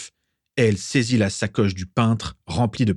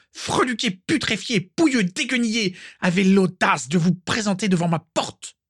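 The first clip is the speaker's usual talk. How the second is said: furious tirade; 12 semitones higher than usual. Acted. Furious witch insults the painter.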